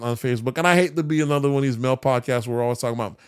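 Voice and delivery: ordinary talk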